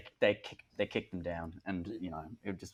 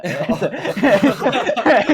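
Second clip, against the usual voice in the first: high, loud burst of real laughter; sudden loud laugh at a joke; 13 semitones higher, 11 dB louder than usual. Real, not acted. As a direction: crack up out loud.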